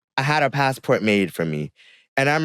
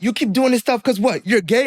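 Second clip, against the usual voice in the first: dad's demand; 11 semitones higher than usual. Retold or imitated. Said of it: "And then he's just like".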